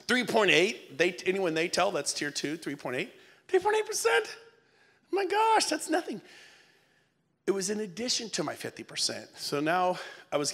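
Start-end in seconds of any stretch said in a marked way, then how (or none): 3.5-6.2 high, incredulous squeal; mock disbelief. Speaker scoffing at a small commission, high disbelief. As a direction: act floored by the number.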